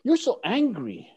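high, drawn-out retold scolding; exasperated reproach. Guru Jun confronting his angry student, high drawn-out dismay.

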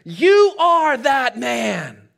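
loud, very drawn-out declaration; slow, ringing confrontation, hard on the first word; forceful accusation. Acted. Nathan turns to David, loudly accusing him.

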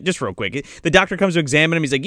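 normal talking voice

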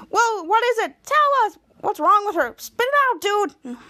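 high, pressing acted urging; impatient demand. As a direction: demand the answer right now. Others impatiently demand the result.

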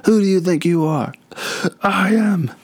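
high, excited bowler yelling at the crowd; imitating a thrilled bowler at the title win; Pete Weber. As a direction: ham up a pumped-up champion's boast. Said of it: "And he's like".

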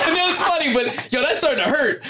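laughing. Retold or imitated. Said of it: "was like".

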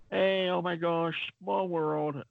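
put-on British accent. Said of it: "cute British accent".

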